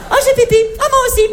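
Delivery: very high mock girly squeal; squeaky girly voice. Comedian mimics girlfriends going to pee together, high squeaky voice. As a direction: play giggly girls chattering on the way to pee.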